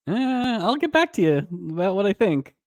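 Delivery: high, hedging mock Carol voice; evasive Carol. Carol dodging Gwen's question, mock evasive. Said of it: "she's just like".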